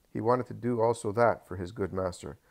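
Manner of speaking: ordinary delivery, nothing marked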